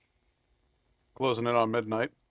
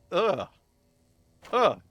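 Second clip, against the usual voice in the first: disgusted groan; 7 semitones higher, 5 dB louder than usual. Real, not acted. Disgust at cat food.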